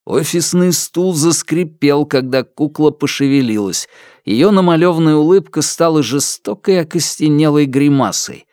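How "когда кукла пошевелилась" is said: usual speaking voice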